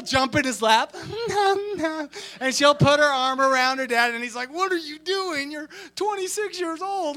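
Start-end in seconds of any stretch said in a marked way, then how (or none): 1.0-2.3 high-pitched mock giggle; little-girl giggle. Imitating sister-in-law jumping in dad's lap, girlish giggle. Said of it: "act like she's six years old again". 4.5-7.2 high-pitched incredulous question; mock dad protest. Imitating dad with daughter in his lap, high incredulous protest. Acting the bemused dad's protest.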